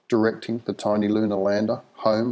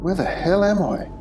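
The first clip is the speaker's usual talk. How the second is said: bewildered; 10 semitones higher than usual. Acted. John demands, bewildered.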